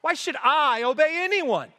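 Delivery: high-pitched, indignant arrogance, leaning on I; haughty, rising outrage in a ruler's voice; self-exalting. Acted. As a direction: protest like an affronted god-king. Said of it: "we see the exaltation of self".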